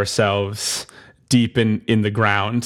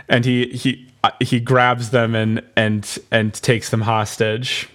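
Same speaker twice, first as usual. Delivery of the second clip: normal talking voice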